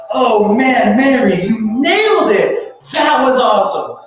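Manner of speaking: loud, high, delighted cheer; excited cheer. Real, not acted. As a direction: cheer her on.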